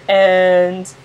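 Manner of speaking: ordinary delivery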